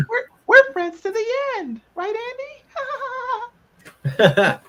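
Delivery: very high, loud toy-like squeak; child's doll voice. Acted. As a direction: pipe it up like a talking doll. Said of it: "it's like child's like".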